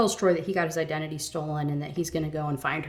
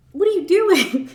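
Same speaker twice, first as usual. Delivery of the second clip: high, loud, exasperated yell; shrieked disbelief; 12 semitones higher, 9 dB louder than usual. Real, not acted. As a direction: shriek it at the book. Exasperated at heroine.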